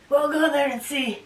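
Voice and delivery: a hint of a Greek dad; Greek accent. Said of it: "in his Greek accent".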